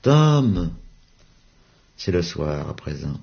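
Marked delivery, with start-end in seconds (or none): 0.0-0.7 distant call. Acted. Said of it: "voix lointaine d'un enfant".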